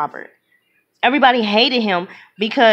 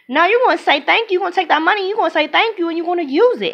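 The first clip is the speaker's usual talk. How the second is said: higher, insistent and emphatic; firm, raised-pitch insistence; 7 semitones higher, faster than usual. Real, not acted. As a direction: drive the point home, pitched up.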